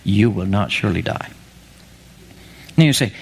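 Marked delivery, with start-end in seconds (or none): 0.0-1.3 a touch lower, sly tempter; lightly voiced devil, low and assured; devil serpent. Preacher voicing the devil to Eve, lightly sly. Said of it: "the devil answered".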